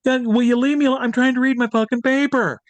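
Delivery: high, loud mock snarl at a pest; angry snap. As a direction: bark it at him as if he won't leave you alone. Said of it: "he looks at me and he says".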